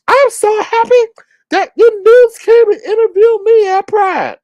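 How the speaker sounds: very high, loud squealing impression; mocking gushy woman. Host mocking woman thrilled at Pride interview, shrieky gushing. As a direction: gush in an exaggerated falsetto, making fun of her.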